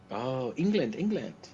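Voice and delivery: high, sing-song vendor call; Turkish street vendor. Retold or imitated. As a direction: hail the tourists like a market seller.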